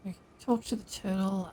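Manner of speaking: ordinary talk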